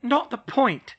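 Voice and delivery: high, loud grumble; groused. Acted. Hermione grousing at George, high and loud. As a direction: snap it back grumpily. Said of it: "she groused".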